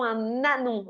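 « Un ânon » avec liaison, « un-nânon ». Shown as a demonstration. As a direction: fais bien sonner le n de « Un » sur « ânon ». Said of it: "on fait la liaison". (liaison appuyée dans « Un ânon »)

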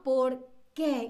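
"por qué" with a weighted E in "qué"; two words, leaning on the falling "qué"; stress on qué. Shown as a demonstration. Teacher repeats the question form for students, weight on "qué". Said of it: "tiene ese peso en la E".

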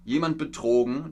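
ordinary talk

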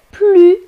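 "plus" as plu before a consonant; teacher models "plus" with silent S; silent final S. Shown as a demonstration. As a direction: draw out "plus" on the u, no S. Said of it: "R, une consonne".

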